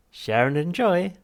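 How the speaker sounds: high, slow, loud cheery machine voice; chirpy machine. Acted. Drink machine chirping its motto at Arthur, cheery machine voice. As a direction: sing it out like a relentlessly cheerful vending machine.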